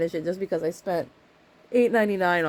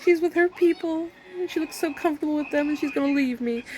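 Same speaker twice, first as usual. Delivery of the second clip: insecure husband; 9 semitones higher than usual. Retold or imitated. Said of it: "he's like".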